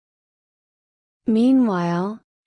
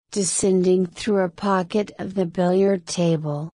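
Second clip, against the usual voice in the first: plain reading voice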